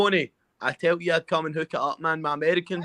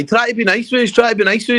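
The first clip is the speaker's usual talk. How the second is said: higher, louder sneering repeat of his words; mocking echo; 7 semitones higher, 8 dB louder than usual. Teasing his friend's polite line back at him, loud and jeering. Mocking mate's trying to be nice.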